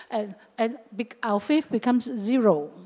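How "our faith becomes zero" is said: ordinary talk, nothing marked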